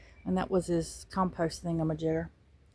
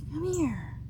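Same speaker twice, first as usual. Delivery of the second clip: high, soft coax; high, soft coaxing of a pet bird; 7 semitones higher, 7 dB quieter than usual. Real, not acted. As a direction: softly lure the bird over.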